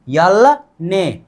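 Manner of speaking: very slow, each syllable apart: यल ने; slow spelled-out demo. Shown as a demonstration. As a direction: draw out यल ने slowly for the class. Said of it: "इसको बोलेंगे ने".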